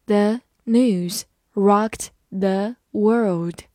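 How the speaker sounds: very slow, every word on its own; slow reading. Shown as a demonstration. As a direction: take it one word at a time. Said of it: "慢慢来读".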